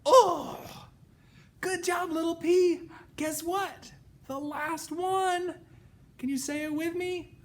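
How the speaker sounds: put-on high, bright praise for kids; broad singsong kids theatre. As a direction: cheer the little one on. Praising the pea.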